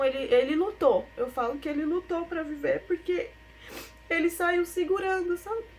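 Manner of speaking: voz embargada, bem mais aguda; choro real. Real, not acted. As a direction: falar segurando o choro, a voz subindo. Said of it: "é impossível falar e não chorar". (voz chorosa e embargada)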